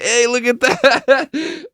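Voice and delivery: high, loud, drawn out through laughter; stretched high-pitched holler, cracking up; excited mock call. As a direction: imitate an excited friend, laughing as you call. Retold friend excitedly pointing it out.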